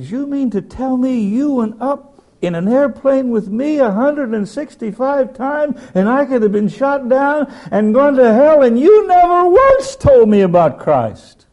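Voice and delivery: loud, high, slow indignant cry; outraged high shout. Retold or imitated. Pilot shakes his silent friend, crying out high and loud. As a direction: shake him with the words, rising in outrage.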